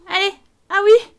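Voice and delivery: cri de joie aigu et fort; s'exclame, ravie. Real, not acted. Reacts to game success. (petit cri de victoire)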